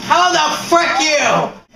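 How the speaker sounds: high, loud, drawn-out disbelief; outraged yell. Real, not acted. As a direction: shout in exasperated disbelief. Outraged at being killed in game.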